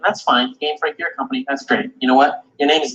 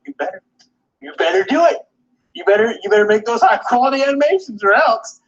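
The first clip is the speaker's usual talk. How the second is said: mock threat; 8 semitones higher than usual. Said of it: "They're like".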